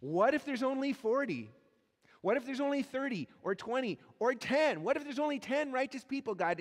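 haggling with God, voice climbing; escalating bold plea. Retold or imitated. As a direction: push harder every time you ask. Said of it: "And yet he keeps going".

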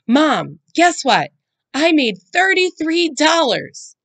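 high, loud excited news; bright little girl bragging; eager. A narrator's reading. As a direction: sell the news to distract her. Daughter distracting mom eagerly.

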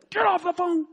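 mock-menacing shout; angry gunman order. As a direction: snap it like an armed robber, acted. Retold gunman barks an order.

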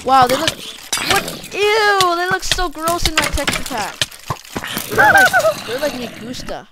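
high loud grossed-out cry; disgusted shriek. Real, not acted. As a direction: squeal in disgust. Disgusted at witches.

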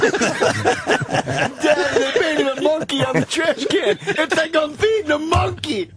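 put-on laughing as a kid asking daddy; child voice.

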